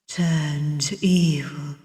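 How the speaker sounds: hushed ominous whisper. A narrator's reading. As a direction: breathe it out darkly. Dark prologue narration.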